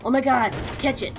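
ordinary talk

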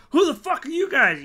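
high, loud hostile yell; angry shout. Acted. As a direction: challenge the intruders at full voice. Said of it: "turns and goes".

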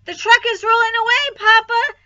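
high, very loud warning call; alarmed shout. A narrator's reading. Girl warning Papa about the truck, shouting.

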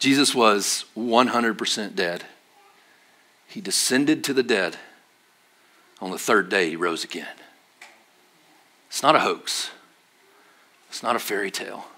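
quiet solemn truth; solemn preaching. Acted. Solemn proclamation of resurrection.

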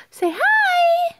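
high gasp, then a squealed greeting. Real, not acted. Mother coaxing baby to greet grandma, high excited coo.

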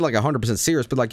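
usual speaking voice

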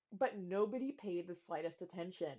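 usual speaking voice

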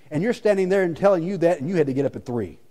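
usual speaking voice

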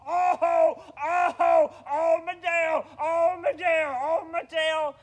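high, very loud wailing; hammed-up howls of agony; screaming in pain. Bear has just lost his tail, screaming in pain. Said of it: "He was yelling and screaming".

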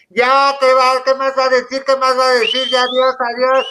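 high, loud impatient mock shoo-off; exasperated "enough already"; fed-up brush-off. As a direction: hurry them off, sick of the speech. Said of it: "le empezó a decir".

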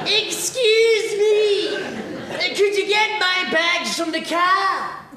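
high, slow, demanding posh lady; posh snooty customer. Retold or imitated. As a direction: ape the haughty customer, high and drawn out. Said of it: "You know that fucking voice?".

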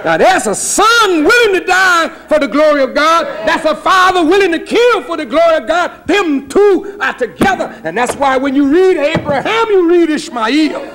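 high, loud, ringing preacher climax; preaching at full force. Acted. Preacher driving home the sacrifice to the congregation, shouting high. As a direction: build to a shouted sermon peak.

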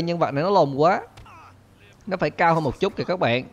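usual speaking voice

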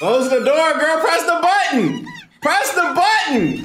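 panicked high yelling; urgent frightened shouting at the screen; scared horror game streamer. Real, not acted.

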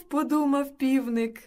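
ordinary delivery, nothing marked

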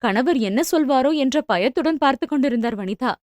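ordinary delivery

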